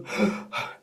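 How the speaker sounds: sharp desperate gasp; gasping for air. Shown as a demonstration. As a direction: show breathlessness with a gasp. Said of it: "don't do it to a point of ... like that".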